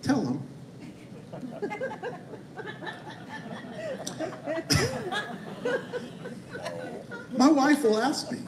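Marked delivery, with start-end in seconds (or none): 1.7-8.4 long soft high laugh into words. Real, not acted. Preacher telling congregation about his wife, laughing higher. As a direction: laugh through the start of the line.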